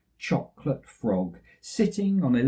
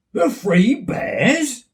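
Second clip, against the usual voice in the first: surprised giant; 8 semitones higher, 8 dB louder, much slower than usual. Acted.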